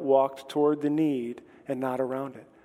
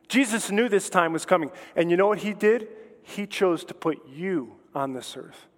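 ordinary talk, nothing marked

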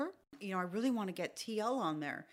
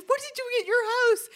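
high, loud question through laughter; amused disbelief; laughing astonishment; 11 dB louder than usual. Retold or imitated. Retelling the manager's reaction, high laughing disbelief.

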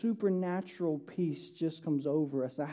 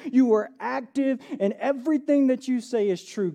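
raised, louder preaching to the congregation; 5 semitones higher, 6 dB louder than usual. Acted. Pastor urging the church, preaching loud and raised. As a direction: preach it out with full force.

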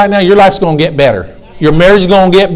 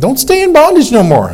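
urgent higher cry; 8 semitones higher than usual. Shown as a demonstration. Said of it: "repeated exhortation".

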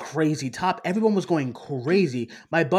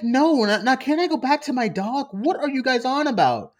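annoyed brush-off; 8 semitones higher than usual. Retold or imitated. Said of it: "he's like".

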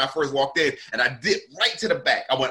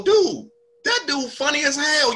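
much higher, slow, with an animated voice; Clyde's excited praise; 12 semitones higher than usual. Retold or imitated. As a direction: hype him up like an old friend. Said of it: "Yeah, like that".

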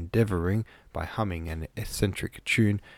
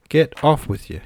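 raised, louder gruff rebuff; gruff growl; 7 semitones higher, 6 dB louder than usual. A narrator's reading. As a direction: read it as a curt, gruff dismissal. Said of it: "he growled, with accompanying gruffness".

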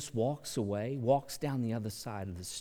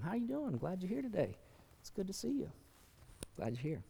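higher, soft and welcoming; friendly greeting; 4 semitones higher, 8 dB quieter, faster than usual. Pretend. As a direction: greet a stranger at the door kindly. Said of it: "just talk to them".

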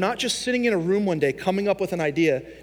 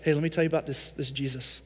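hushed aside; 4 semitones lower, 6 dB quieter, faster than usual. Retold or imitated. Paul whispers to cellmate.

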